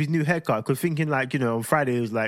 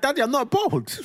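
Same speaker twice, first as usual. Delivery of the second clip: put-on higher little-boy voice; playful high child impression; imitating young son; 10 semitones higher than usual. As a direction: mimic a kid reporting to his dad. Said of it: "He's like".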